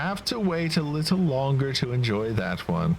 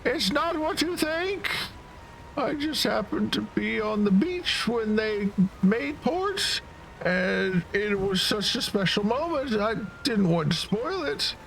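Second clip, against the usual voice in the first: put-on very high, sweet defensive girl; 13 semitones higher than usual. Girl explains to the newcomers she meant no harm, high and sweet. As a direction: pitch way up and plead innocence. Voices Lulu explaining herself.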